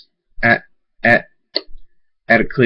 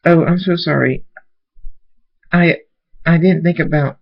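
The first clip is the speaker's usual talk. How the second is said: upset apology; 7 semitones higher than usual. A narrator's reading. Apologizing to a grieving friend.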